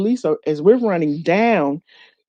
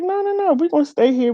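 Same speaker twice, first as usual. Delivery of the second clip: high, firm insistence; lightly voiced friend refusing to leave; quoting the friend who insists they do the show; insistent friend; 10 semitones higher, faster than usual.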